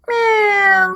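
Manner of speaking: very high sustained meow; high drawn-out kitten meow; cat meow. Shown as a demonstration. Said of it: "Bagaimana suara kucing?".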